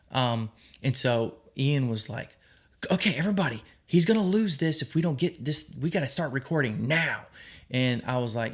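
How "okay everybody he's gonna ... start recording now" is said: imitating an urgent director, raised pitch; rally the crew before the moment slips away. Director urgently rallies crew to film.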